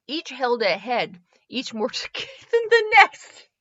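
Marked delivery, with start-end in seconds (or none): 1.5-3.6 laughing. Real, not acted. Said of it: "spooky narration laughing".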